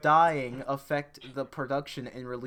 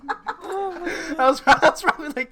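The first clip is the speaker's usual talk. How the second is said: laughing; 13 dB louder than usual. Real, not acted. Group cracks up at joke.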